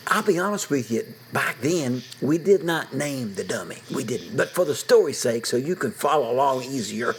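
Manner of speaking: usual speaking voice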